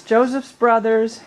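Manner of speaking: ordinary delivery, nothing marked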